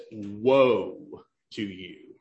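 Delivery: slow each word of "Woe to you" down, grave and low; solemn pronouncement. Shown as a demonstration. Preacher reading the Bible's verdict to listeners, slow and grave. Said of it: "the Bible says".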